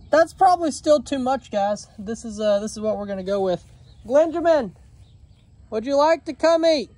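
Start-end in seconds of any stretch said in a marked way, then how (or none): 4.1-6.9 calling out. Real, not acted. Calling the goat to breakfast.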